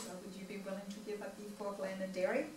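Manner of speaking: a light imitation of an Indian doctor's calm accent; Doctor Sid's Indian accent. Imitating her Indian doctor's calm, soft Indian accent. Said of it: "in his Indian dialect, very calmly".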